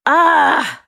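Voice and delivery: sharp pained cry, pitched high and loud; tearful yell. Acted. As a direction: let out a hurt cry through tears. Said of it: "I yelled at her with tears in my eyes".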